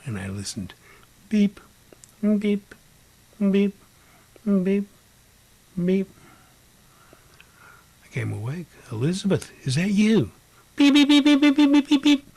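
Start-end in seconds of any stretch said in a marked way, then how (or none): 1.3-6.1 high, short, evenly spaced beeps; loud high electronic-style beep with gaps; imitated machine beep. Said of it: "Your machine's going off". 8.9-10.3 raised, rising pitch; higher-pitched hopeful question; surprised questioning. Retold or imitated. Waking, calling out to a ghost. 10.8-12.3 rapid high, loud beeps; shrieking electronic alarm on one pitch; imitated machine beeping. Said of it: "Your machine's going off".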